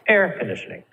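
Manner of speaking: "air conditioning" with AIR punched high, then falling away; stress on first part. Shown as a demonstration. Teacher showing students compound noun stress, stress on AIR in "air conditioning". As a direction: hit AIR in "air conditioning", let "conditioning" drop. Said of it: "you're gonna stress the first part".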